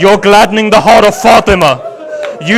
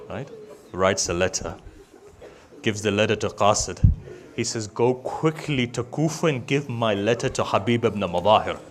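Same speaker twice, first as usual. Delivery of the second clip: low, much softer, almost breathed grief; reciting the tragedy far softer and lower; hushed lament; 10 semitones lower, 12 dB quieter than usual. Real, not acted. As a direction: drop to a near-silent mourning hush.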